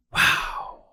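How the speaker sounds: breathless unvoiced wow; stunned whisper at the sight; whispered awe. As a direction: let it out as a hushed gasp of awe. Stunned by the other patients.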